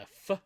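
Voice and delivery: F as a short, bare "fuh"; F sound. Shown as a demonstration. Teacher introducing place names that start with a bare F sound. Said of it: "that start with".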